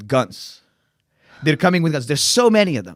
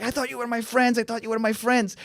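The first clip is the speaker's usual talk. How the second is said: wounded plea; 9 semitones higher, 3 dB quieter than usual. Retold or imitated. Jilted man chasing them.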